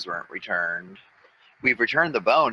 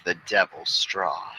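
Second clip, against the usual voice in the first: low, slow and soft dread; ominous dread; 5 semitones lower, 4 dB quieter, slower than usual. Real, not acted. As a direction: say the name with dread. Dread at the name.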